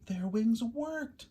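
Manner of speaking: slow amazed discovery. A narrator's reading. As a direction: gasp it out in delight. Babies take first flight.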